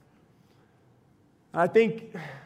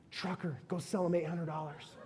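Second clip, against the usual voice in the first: hushed, lower aside to his daughter; quiet aside; 5 semitones lower, 16 dB quieter than usual. Retold or imitated. Father hushes instructions to his daughter, low aside. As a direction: tip her off under your breath. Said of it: "and I'll go".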